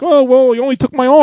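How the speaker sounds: lightly hammed-up survivor's reaction; mock bite victim. Imitating shark bite victims for the co-host, mock high voice.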